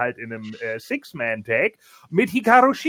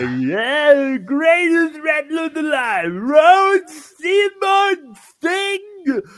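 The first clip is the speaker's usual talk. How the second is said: much higher, loud, stretched-out promo yell; hyped wrestling promo; 12 semitones higher, 6 dB louder, much slower than usual. Retold or imitated. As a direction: drag out the name like a hype man. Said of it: "Oder sagt er".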